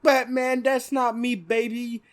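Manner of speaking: Penguin. Acted. Penguin denying it to Batman, thick accent. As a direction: plead innocence in a hammed-up mobster accent. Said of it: "thick fucking accent".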